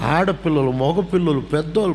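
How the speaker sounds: normal talking voice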